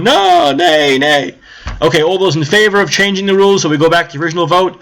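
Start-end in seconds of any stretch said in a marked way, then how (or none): 0.0-1.3 a sudden high yelled no, then flat nays; screamed no. Retold or imitated. Bernie crowd yelling no at the vote. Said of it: "they scream".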